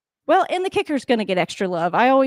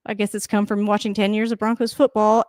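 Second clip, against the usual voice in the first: normal talking voice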